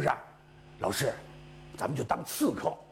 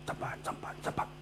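hushed whisper, as if nobody may overhear; secret whisper; 10 dB quieter, much faster than usual. Acted. Disciple whispering his secret plan to the teacher, hushed whisper.

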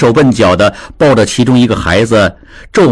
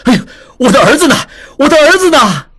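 much higher, fast eager calling; excited running shout; 14 semitones higher, faster than usual. A narrator's reading. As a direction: burst in shouting for his sons. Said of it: "边跑边喊". (智庸边跑边兴奋地喊)

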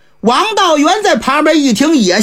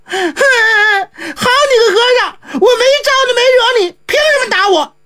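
put-on higher child's voice, sobbing complaint; boy crying and yelling at the monk who slapped him; 11 semitones higher than usual. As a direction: wail at the monk like a hurt boy. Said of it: "连嚷嚷带哭".

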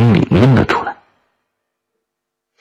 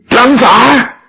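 very high-pitched excited yell; wild cry; 4 dB louder than usual. A narrator's reading. Three Beijing youths spot the wolf cubs, wild high cry. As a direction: cry out in a frenzy of discovery. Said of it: "都狂叫了起来".

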